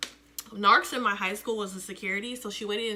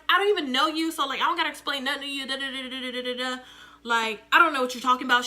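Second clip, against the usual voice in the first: mimicking the bully acting dumb with attitude; dismissive retort; 3 semitones higher, 5 dB louder, faster than usual. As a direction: brush them off like they're nothing. Bully retorting, voiced higher.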